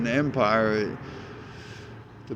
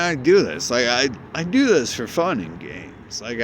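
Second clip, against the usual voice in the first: usual speaking voice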